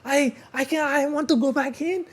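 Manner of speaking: high-pitched imitated Chinese accent; imitation of a distraught woman with Chinese accent; mock Chinese accent. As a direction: plead in a put-on Chinese accent. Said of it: "You're doing the accent".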